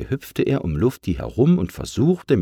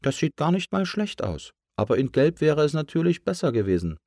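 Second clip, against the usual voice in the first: high cartoon balloon, playfully picky; high-pitched tongue-in-cheek remark; teasing balloon; 7 semitones higher than usual. A narrator's reading. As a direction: say it with a wink in the voice. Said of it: "setzte er mit einem zwinkernden Auge hinzu".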